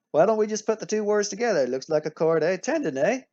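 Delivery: badly faked Canadian, punching each questioning "eh"; mock Canadian accent. Teacher as Canadians agreeing on a name, mock Canadian accent. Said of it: "forgive me for my terrible accent".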